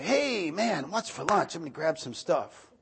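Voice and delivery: high, easy, relaxed greeting; casual son at home. Retold or imitated. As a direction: breeze in like you own the place. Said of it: "would open the fridge and say".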